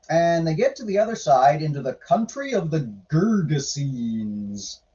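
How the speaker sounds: usual speaking voice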